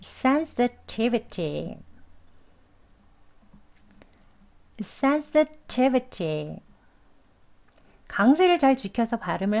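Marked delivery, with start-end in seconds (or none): 0.0-6.6 "sensitivity" drawn out very slowly, SEN and TI stressed; very slow "sensitivity" drill. Shown as a demonstration. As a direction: stretch "sensitivity" syllable by syllable. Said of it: "두 번 해볼게요".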